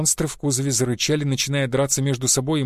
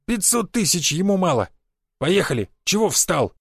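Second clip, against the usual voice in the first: indignant yelling; 4 semitones higher than usual. A narrator's reading. Offended aristocrat yells at driver.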